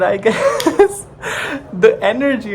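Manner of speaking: laughing through the words; breaking into a giggle. Real, not acted. Laughs mid-word.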